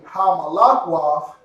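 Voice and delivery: ordinary talk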